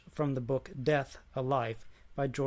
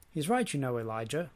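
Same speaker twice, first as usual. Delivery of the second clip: usual speaking voice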